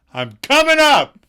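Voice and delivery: high, loud stage holler; in-character shout. Acted. [name] in character to Lauren, loud shout. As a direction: bellow it up to a window. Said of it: "he shouts".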